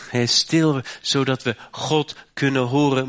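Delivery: ordinary delivery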